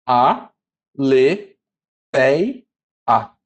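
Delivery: A, le, pei, a broken apart with a pause after each syllable. Shown as a demonstration. Teacher splitting aletheia into syllables for students, slow and deliberate. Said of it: "Então, vai ficar quantas?".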